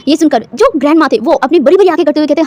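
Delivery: usual speaking voice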